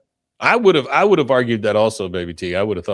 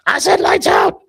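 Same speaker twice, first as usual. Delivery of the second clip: much higher, louder mother yell; Peggy yelling at Bobby to sleep; mock yell; 5 dB louder than usual. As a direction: call it sharply from another room. Peggy yelling from other room.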